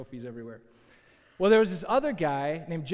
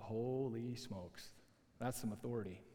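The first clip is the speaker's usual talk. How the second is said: hushed awe; 6 semitones lower, 13 dB quieter than usual. Retold or imitated. Student marveling at Mike's authority, hushed awe. Retold awed thought after the fight.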